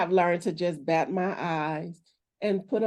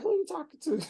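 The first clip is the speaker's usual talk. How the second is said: high, sassy who-are-you-talking-to; sassy indignant retort; 9 semitones higher, 4 dB quieter, faster than usual. Retold or imitated. Wife retelling her reply to husband, sassy and indignant. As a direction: push back with mock outrage. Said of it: "I was like".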